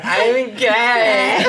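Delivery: high, loud, laughing declaration; dramatic teen announcement. Retold or imitated. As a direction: ham up the big coming-out. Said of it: "And I'm just like".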